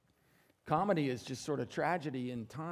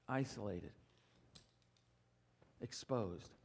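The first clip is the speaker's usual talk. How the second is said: quiet and much lower, let each word hang; hushed, dramatic; 8 semitones lower, 10 dB quieter than usual. A narrator's reading. Preacher painting Achan standing alone, quiet and grave. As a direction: drop to a near hush for the exposure.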